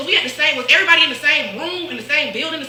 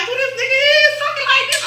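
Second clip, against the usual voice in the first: much higher, put-on voice of a friend on the phone; mock disbelief; 13 semitones higher than usual. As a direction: squeak it out in disbelief. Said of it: "My homeboy like".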